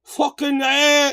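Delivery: a hammed-up Irish accent from the trailer; mocking McGregor's accent. Mocking Conor McGregor's accent in the trailer. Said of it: "couldn't you have at least tried".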